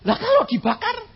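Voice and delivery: high anxious question; worried caller. Retold or imitated. Caller fears being burned: high worried question. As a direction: ask fearfully what if they burn.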